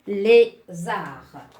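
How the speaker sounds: Lézard called out loud, the é clear and bright; stressed accent aigu. Shown as a demonstration. Teacher calling out Lézard to show the accent aigu. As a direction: project Lézard, hitting the first é. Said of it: "je m'intéresse à l'accent aigu".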